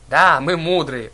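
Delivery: slow, loud smug drawl; smirking. A narrator's reading. Old sister boasting to passengers, smirking slowly. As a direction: stretch it out with a smirk. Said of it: "усмехнулась в зеркальце заднего вида Дрожь".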